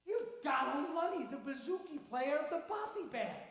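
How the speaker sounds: thrilled recognition; starstruck. Acted. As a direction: gush at the musician. Fan realizes who he is.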